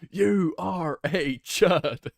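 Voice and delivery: loud, high, very slow cartoon voice; hammed-up cowboy toy, each word stretched; Woody. Streamer trying the voice between laughs, slow cartoon cowboy. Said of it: "What does Woody sound like?".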